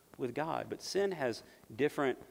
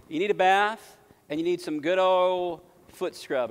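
louder and higher, punching bath; emphatic repeat; 6 semitones higher, 8 dB louder than usual. Shown as a demonstration. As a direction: hammer the point home the second time.

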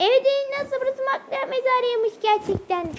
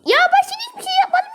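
whiny protest; 11 semitones higher, 5 dB louder than usual. Real, not acted. Frustrated kid protesting in game.

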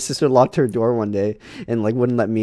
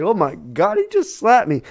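shocked; 9 semitones higher than usual. Retold or imitated. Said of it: "like, shocked".

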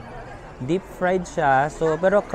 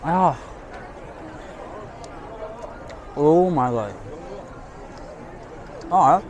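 speaking between bites; chewing while talking; 4 semitones higher than usual. Real, not acted. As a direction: mouth full of food. Seen 0: bites at -3, chews visibly through +7, then talks clearly.

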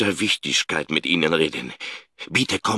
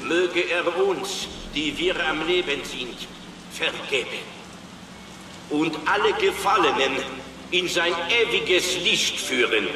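solemn prayer; 11 semitones higher than usual. Acted. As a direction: intone the blessing over the congregation. Priest holds service prayer.

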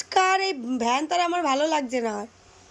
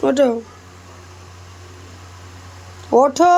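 wake-up calls; 3 semitones higher, 3 dB quieter than usual. Real, not acted. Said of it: "সকালবেলা রোজ আমার এরকম চেঁচাতে হয়".

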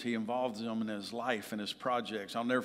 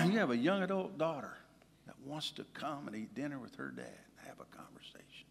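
scolding himself; 4 semitones higher, 7 dB louder than usual. Retold or imitated. Speaker scolding himself inside, hushed whisper. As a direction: hiss inner self-scolding under the breath. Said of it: "I was like".

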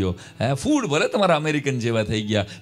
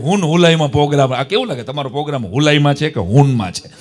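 loud teasing; 9 dB louder than usual. Retold or imitated. Comedian teasing with H words.

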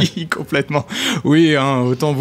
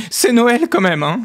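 vieille dame outrée; 9 semitones higher than usual. Acted.

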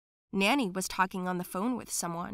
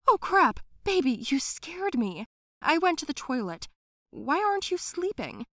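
higher, jumpy and caught off guard; startled nanny; 7 semitones higher, 3 dB louder than usual. Acted. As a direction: caught red-handed, cover it up.

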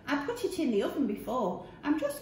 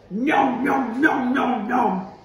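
munching noises; 7 dB louder, slower than usual. Acted. Fox gobbling him up.

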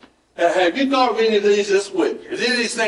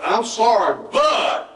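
"I'm sorry" pitched up, then "but" punched high; spoiled apology; 5 semitones higher, slower than usual. Acted. Preacher acting out a ruined apology, stressing "but". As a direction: hit "but" sharply after the apology. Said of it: "And I go".

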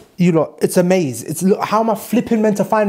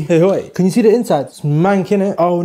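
ordinary talk

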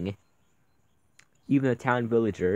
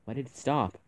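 ordinary talk

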